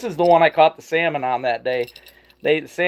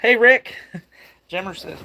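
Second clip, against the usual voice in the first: ordinary talk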